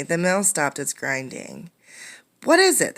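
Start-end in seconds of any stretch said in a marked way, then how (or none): 2.4-3.0 very high, loud shout over the noise; miller's shout. Acted. Miller shouting at the crowd, loud and high. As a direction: bellow a demand to know. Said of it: "the miller shouted".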